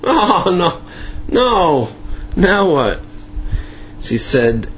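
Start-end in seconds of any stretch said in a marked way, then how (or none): 0.0-3.0 high wail of mock dread; acted-out fed-up protest; exasperated dismay. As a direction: groan at the unwelcome visitor. Retold Ziskin dreads messenger's return.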